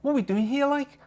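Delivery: high-pitched amateur Scouse; Liverpudlian accent. Acted. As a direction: repeat the practice line in put-on Liverpudlian. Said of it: "I can't do the Liverpudlian accent".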